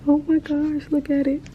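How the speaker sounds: thrilled at an animal visitor; excited. Real, not acted. Sees a baby animal.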